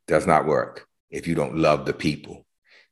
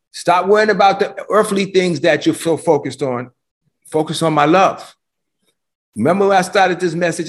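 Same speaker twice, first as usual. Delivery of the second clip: full-force preaching; 9 semitones higher than usual. Acted. Pastor urging his church not to be troubled, full-force preaching. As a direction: lift it up and drive it home.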